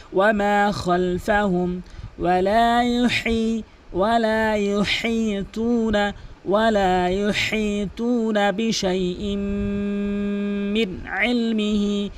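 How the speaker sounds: slow, melodic chanted recitation; Quran recitation. Shown as a demonstration. Teacher recites for students, slow melodic chant. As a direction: chant the verse slowly with long held vowels.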